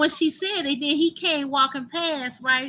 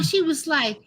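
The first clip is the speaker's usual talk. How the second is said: ordinary delivery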